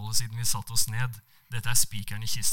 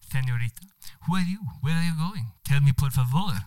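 charming Spanish man with a put-on accent; 4 semitones higher than usual. Spanish diplomat charming the woman on the train, Spanish accent. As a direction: play the suave Spanish diplomat. Manuel introduces himself.

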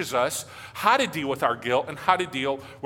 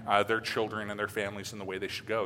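ordinary delivery

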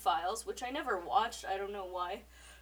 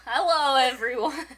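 loud laughing hello; laughing intro; 4 semitones higher, 9 dB louder than usual. Real, not acted. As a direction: greet through laughter.

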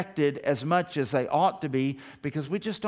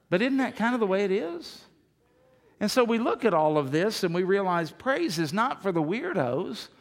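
ordinary talk, nothing marked

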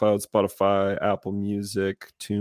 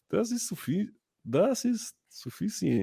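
high, soft mock-accented German; playful high foreign accent, breaking into laughter; put-on accent in German; 10 semitones higher, 4 dB quieter than usual. Playing mixed accents, German with foreign word.

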